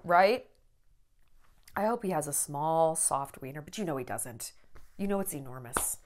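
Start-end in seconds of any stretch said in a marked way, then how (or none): 1.8-6.0 muttered aside. Real, not acted.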